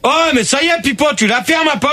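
real irritation, high-pitched; irritated outburst. Real, not acted. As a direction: snap at a heckler, pitched high. Host telling heckler to shut up.

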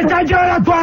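high-pitched irritated yell; angry outburst. Real, not acted. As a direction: shout the interrupter into silence. Host cut off, snaps at caller. (coup de gueule agacé, aigu)

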